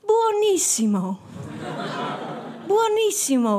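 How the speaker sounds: mock-delighted Italian gushing; Italian praise. Narrator forcing praise of the maggot cheese, hammed-up Italian. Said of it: "Ik nam een hap en ik zei".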